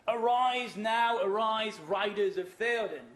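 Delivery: slow, loud, stirring battle-call recital; rousing poem recital. Acted. Lecturer reciting the riders' call to students, slow and loud. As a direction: declaim it like a king rallying riders.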